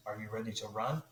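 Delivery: a light, self-mocking South African accent on the guide's question. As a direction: imitate the hunting guide's South African accent, only half-committed. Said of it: "the best South African accent I have".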